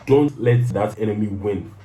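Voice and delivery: usual speaking voice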